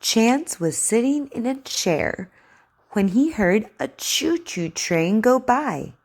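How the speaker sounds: slow, clear story reading; over enunciating. Acted. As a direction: read to a child, carefully. Reads the story aloud for practice.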